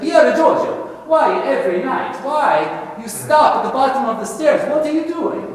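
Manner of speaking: high-pitched mock-Italian mama scolding; terrible Italian accent. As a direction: question the boy in a hammed-up Italian accent. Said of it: "here's my terrible Italian accent".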